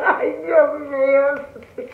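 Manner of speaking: laughing through pain. Real, not acted. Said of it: "estuve en un ataque de risa".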